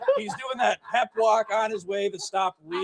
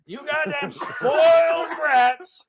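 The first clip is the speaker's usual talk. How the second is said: put-on slower, higher furious old man yell; angry boomer yell; 4 semitones higher, slower than usual. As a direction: stretch it out like a ranting boomer. Boomer yelling at youth.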